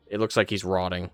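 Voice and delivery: ordinary delivery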